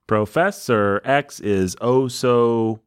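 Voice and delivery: slow, stretched syllable-by-syllable delivery; metrical scansion. Shown as a demonstration. Host building a line of pentameter, slow scansion. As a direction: feel out the beats one by one. Sounding out the meter.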